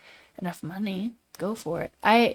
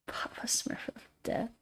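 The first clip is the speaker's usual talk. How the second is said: whispered; 10 dB quieter than usual. Real, not acted. Rereading the name under breath.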